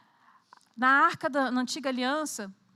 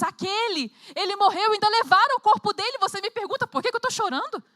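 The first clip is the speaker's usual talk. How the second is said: anguished grief; 4 semitones higher, 4 dB louder than usual. Pretend. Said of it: "E se eu tivesse no lugar dela".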